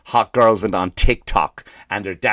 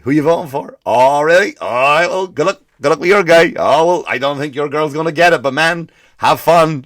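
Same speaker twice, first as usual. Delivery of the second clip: cheery friendly banter; 4 semitones higher, 5 dB louder than usual. Retold or imitated. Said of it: "friendly political banter retold".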